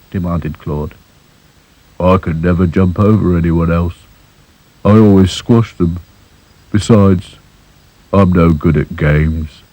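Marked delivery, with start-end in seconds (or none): 2.0-9.6 glum doll. Acted. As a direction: sound sorry for yourself. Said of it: "Sad Sack just sighed".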